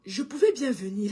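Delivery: ordinary talk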